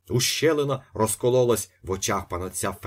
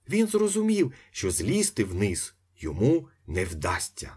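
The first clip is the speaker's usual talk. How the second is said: ordinary delivery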